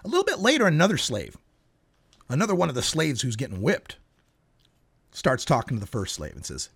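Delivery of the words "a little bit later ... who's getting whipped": usual speaking voice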